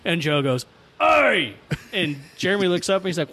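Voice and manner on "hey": high, loud holler; calling out. Retold or imitated. Cousin in tree calling to his brother, loud holler. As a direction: holler it sharply to get his attention.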